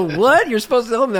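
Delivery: high, loud mock disbelief; shocked fan. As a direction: squawk it like a betrayed fan. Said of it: "And they go".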